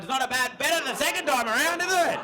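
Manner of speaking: high, loud, over-excited dad; mock dad thrilled by the repeat; imitating dads. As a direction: build to a high excited peak. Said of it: "The dads are being like".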